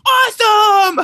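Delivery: put-on high, loud, delighted child's cheer; excited kid. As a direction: shout it like a thrilled little boy. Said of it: "Damien, he's like".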